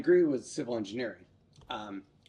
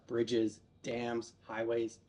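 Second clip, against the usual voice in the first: ordinary talk, nothing marked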